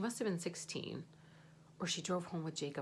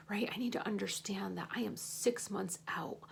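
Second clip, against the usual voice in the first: usual speaking voice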